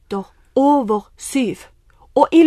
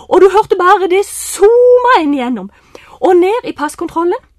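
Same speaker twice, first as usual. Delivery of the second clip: ordinary delivery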